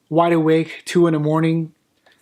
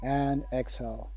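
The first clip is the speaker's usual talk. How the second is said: softer, lower meditation voice with long pauses; hushed, unhurried breathing cues; guided sleep meditation; 3 semitones lower, 12 dB quieter than usual. Acted. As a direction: guide them gently into sleep, leaving room for each breath.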